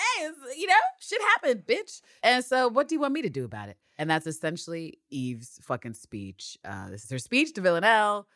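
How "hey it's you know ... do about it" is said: high-pitched, flippant send-up; mocking parody. Podcaster parodies Eve's speech to Villanelle, high and flippant. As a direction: toss it off as absurd dialogue.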